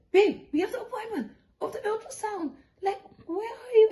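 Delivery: urgent and panicky, high and loud, with a laugh turning to tears; frantic high call. Retold or imitated. To her late husband on the phone, high frantic and tearful.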